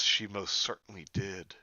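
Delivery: plain reading voice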